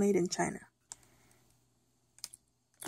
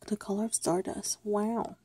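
usual speaking voice

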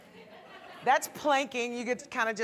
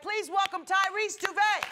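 announcer voice; 7 semitones higher than usual. Acted. Host announces guest.

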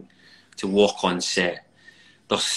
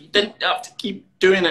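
put-on sulky child; 10 semitones higher, 7 dB louder than usual. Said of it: "he's like".

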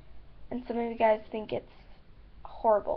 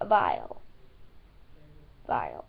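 mock deep vile; 3 dB quieter than usual. Deep mocking Vile quoting viewers' verdict. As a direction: drop way down and stretch Vile like the commenters. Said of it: "You guys thought it tasted vile".

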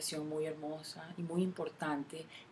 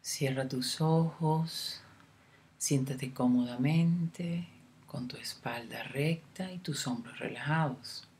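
guided meditation; 4 dB louder than usual. Acted. Guide leading a listener through a love meditation, unhurried meditation voice. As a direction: lead the listener gently inward, phrase by phrase, leaving room to breathe.

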